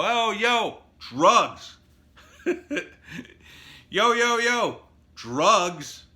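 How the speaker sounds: loud, high, brash street call; open dealer call. Retold or imitated. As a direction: shout it openly across the corner. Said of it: "They can get on the corner and go".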